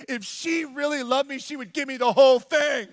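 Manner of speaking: put-on crying child. Boy given only half a cupcake, mock tearful wail. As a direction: imitate a tearful child protesting, building. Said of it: "he starts crying".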